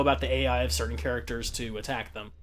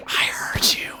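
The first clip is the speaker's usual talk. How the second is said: voiceless mock teacher reply; breathy retort; slower than usual. Teacher finally answers the kid, in breathy fake whisper. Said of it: "out loud".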